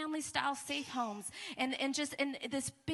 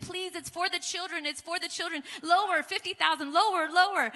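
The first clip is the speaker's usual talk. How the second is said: pleading haggle; 5 semitones higher, 4 dB louder than usual. Retold or imitated. Haggling with mafia bosses.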